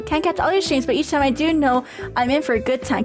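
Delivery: ordinary talk, nothing marked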